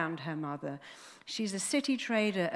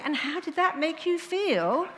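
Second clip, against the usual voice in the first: much higher and louder, sing-song exasperated parody; mock psychiatrist; 10 semitones higher, 5 dB louder than usual. Ridiculing a psychiatrist's stock question, high loud sing-song mockery. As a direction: lampoon the stuck therapist. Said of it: "So I just keep saying".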